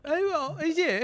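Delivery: very high-pitched mock British mumbling; mock British accent. Co-host showing how his British accent wanders, mock British. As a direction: squeak out vague British noises. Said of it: "just usually just end up going".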